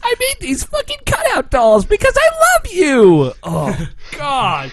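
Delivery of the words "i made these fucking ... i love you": very high, gushing squeal; hammed-up squeaky dub heroine; friendship-preaching girl. Friend parodying the girl's friendship speech, squealed high. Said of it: "what's her face talking about friendship".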